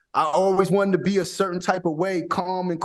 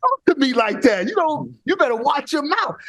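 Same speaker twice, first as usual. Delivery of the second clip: mock angry retort; 7 semitones higher than usual. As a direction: bark back at the trash talker. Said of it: "I said".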